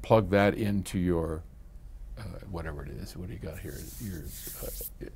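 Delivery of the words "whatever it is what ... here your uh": whispered aside. Real, not acted. Aside while looking at gear.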